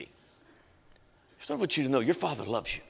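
put-on God speaking softly to an embarrassed teen; gentle divine voice. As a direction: speak it like a quiet inner voice. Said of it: "God's presence to come on me and teach me".

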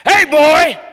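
put-on old man yelling at a teen again; yelling across room. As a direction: call out over a noisy crowd. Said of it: "and he went".

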